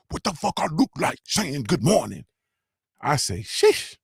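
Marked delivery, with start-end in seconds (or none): 0.1-2.2 high-pitched mimicry of a brash rapper; mock confrontational young woman, pitched up; Scarlett impression. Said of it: "She say". 3.4-4.0 very high-pitched exclamation; exaggerated, taken-aback yelp; stunned reaction. Acted reaction to rude line.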